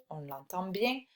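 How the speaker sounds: usual speaking voice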